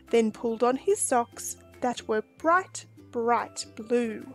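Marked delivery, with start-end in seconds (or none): none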